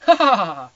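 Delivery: loud hammed-up ha ha; mock loud laugh. Gray laughing at Ana, loud mock laughter. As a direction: bark out a fake laugh.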